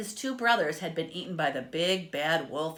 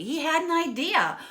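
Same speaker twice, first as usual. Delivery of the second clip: higher, brighter with sudden excitement; excited idea; 9 semitones higher, 6 dB louder than usual. A narrator's reading. Narrator revealing the pig's sudden idea, excited. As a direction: announce the idea eagerly.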